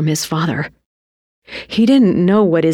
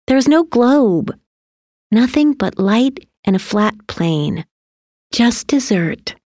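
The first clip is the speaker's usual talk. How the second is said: higher-pitched mother voice, listing; lightly voiced mother, insistent; earnest mother; 7 semitones higher than usual. A narrator's reading. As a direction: reveal the truth item by item. Mother reveals the truth.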